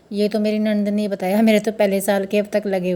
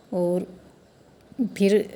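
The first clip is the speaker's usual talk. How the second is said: usual speaking voice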